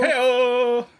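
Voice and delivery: high, loud hammed-up cheer; Ed McMahon hey-oh. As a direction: shout it like a talk show sidekick. Copying the catchphrase for soundboard.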